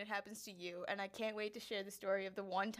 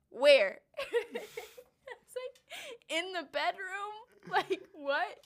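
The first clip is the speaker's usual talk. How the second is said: stifled giggles; 9 semitones higher, 7 dB louder, much slower than usual. Real, not acted. Giggling through retold dialogue.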